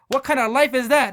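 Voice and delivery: loud, high, exasperated father; retold rant at full voice; scolding dad. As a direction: hurl it as an outraged parent's question.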